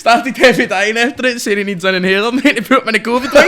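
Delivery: half laughing, half shouting the punchline. Real, not acted. Telling his mate the absurd story, laughing loudly.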